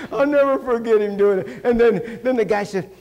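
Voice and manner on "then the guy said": ordinary talk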